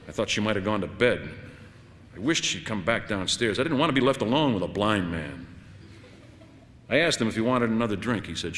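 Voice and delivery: plain reading voice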